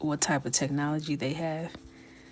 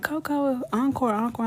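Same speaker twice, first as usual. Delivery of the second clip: higher, louder mock fan cheer; imitating excited fans; 7 semitones higher, 4 dB louder than usual. Fans cheering for [name] to sing more. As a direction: chant for an encore like a fan. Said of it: "y'all stop being like".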